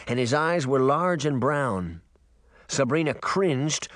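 ordinary delivery, nothing marked

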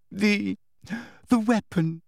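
high, halting answer; faltering confession. A narrator's reading. Hermione giving in to Umbridge, high faltering confession. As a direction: falter before the key word.

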